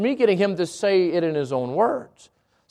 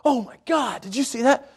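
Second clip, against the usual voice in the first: shocked exclamation. Shown as a demonstration. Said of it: "these are the wrong ways to say it".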